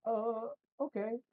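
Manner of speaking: high, startled uh okay; baffled okay. Retold or imitated. Told he doesn't have to do it, baffled okay. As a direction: go way up, thrown off. Reacting to surprising advice.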